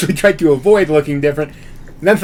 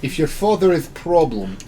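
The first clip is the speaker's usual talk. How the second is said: put-on accidental slide into Russian accent; broken English in a Russian accent; amateur Russian accent; bad Russian accent; 5 dB quieter than usual. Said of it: "Now you're Russian".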